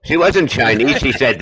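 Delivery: normal talking voice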